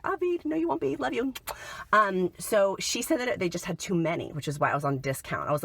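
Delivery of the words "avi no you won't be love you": higher, sing-song reassurance to the store lady; sweet reassuring quote. Retold or imitated. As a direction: coax her kindly, pitched up high. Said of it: "And I was like".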